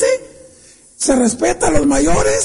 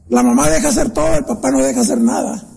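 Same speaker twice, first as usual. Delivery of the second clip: ordinary delivery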